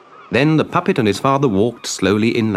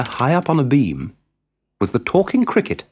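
usual speaking voice

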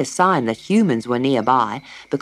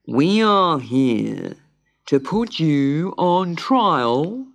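slow, ponderous pelican pronouncement; solemn pelican judge; 3 dB louder, much slower than usual. A narrator's reading. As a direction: draw out each word with great gravity.

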